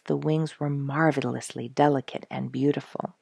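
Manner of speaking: ordinary delivery, nothing marked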